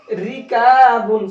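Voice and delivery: slow, clear "रिकाबुन", "kaa" held long; clear thin Ra. Shown as a demonstration. Teacher telling students to read clearly, thin Ra. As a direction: enunciate "रिकाबुन" with a light R. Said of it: "साफ साफ पढ़ेंगे".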